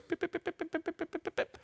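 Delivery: high soft beeping pips; brain beeping. Retold or imitated. Speaker imitating Sherlock's brain as high soft beeping pips. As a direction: beep like a thinking machine, high and soft. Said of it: "his brain goes".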